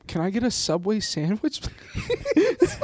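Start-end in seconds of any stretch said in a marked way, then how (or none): 1.6-2.8 very high, laughing as you say please; squeaky laughing please. Real, not acted. Joking Subway order, laughing.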